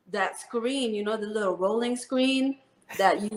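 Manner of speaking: ordinary talk, nothing marked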